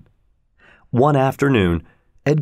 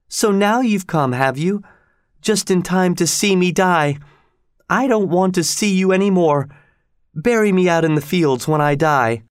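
high-pitched dramatic reproach; exclaiming; 8 semitones higher than usual. A narrator's reading. Dying woman reproaches the one who came, exclaiming. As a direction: exclaim it bitterly, pitched well up. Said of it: "she exclaimed".

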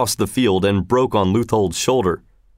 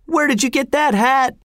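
very high, jeering call at normal pace; crowd shout. A narrator's reading. Crowd taunting the soldiers, shouting. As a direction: heckle in a high-pitched holler, words run together. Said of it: "Shouts of".

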